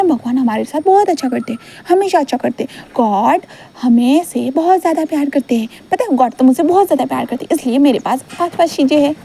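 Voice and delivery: put-on a small boy insisting God loves us; earnest child. Child Wing protests, preaches.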